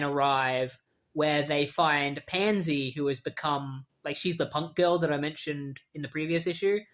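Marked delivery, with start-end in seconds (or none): none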